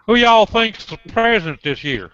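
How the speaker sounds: hammed-up Texan voice; Texas accent. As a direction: put on a Texan accent to quiz the computer. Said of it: "try a Texas accent".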